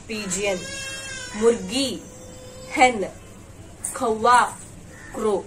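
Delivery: each word said clearly on its own, a pause between; model pronunciation. Shown as a demonstration. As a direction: say every bird name carefully for the class to copy. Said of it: "Once listen my pronunciation".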